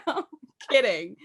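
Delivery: ordinary talk, nothing marked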